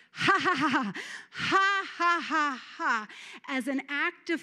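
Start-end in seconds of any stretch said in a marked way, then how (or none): 0.1-3.1 loud, forced ha-ha laugh as an act of faith; deliberate faith laughter. Shown as a demonstration. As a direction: laugh out loud on purpose to stir up joy. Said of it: "as an act of faith in my flesh, I have just".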